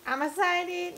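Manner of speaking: high, loud excited reply. Real, not acted. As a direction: burst out with excitement. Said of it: "You excited?".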